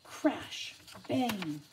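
ordinary delivery

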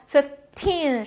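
put-on "fifteen" with a short "fif", strong long "teen"; stress on TEEN. Shown as a demonstration. Teacher drilling teen numbers, stress on the last syllable. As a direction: slowly, push the end of "fifteen". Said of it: "使劲儿".

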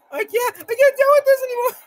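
very high, loud wail; overwhelmed high-pitched whine; squealing despair. Real, not acted. Overwhelmed in boss fight.

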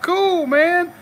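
high-pitched retold reply. Retells reply to vet, laughing.

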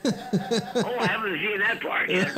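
chuckling while talking; laughing through the words. Real, not acted.

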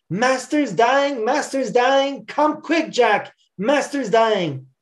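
high, drawn-out alarmed call; urgent crying out. A narrator's reading. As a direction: cry the alarm across a field. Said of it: "a messenger came down to the cotton field crying".

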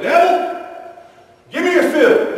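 high, loud tough-guy taunt; macho challenge. Acted. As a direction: bark it like a cocky brawler. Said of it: "Don't ever look at the devil and say".

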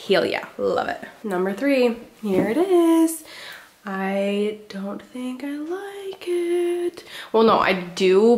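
ordinary talk, nothing marked

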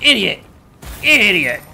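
loud, high jeer; shouted insult. Real, not acted. Player attacking inattentive guards, loud scornful shout. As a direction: yell it at a beaten enemy.

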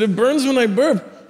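son's plaintive retelling, drawn out; complaining child. Retold or imitated. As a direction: imitate a child telling mom what hurts. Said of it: "I said".

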